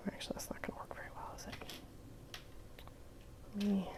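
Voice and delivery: whispering to herself, fast; a quick whispered aside. Real, not acted.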